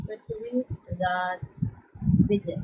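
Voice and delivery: Prithviraj Vijay spelled out slowly and softly for notes; slow dictation. Shown as a demonstration. Teacher to class dictating the book name, slow and soft. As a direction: drag out Prithviraj Vijay so students can write it.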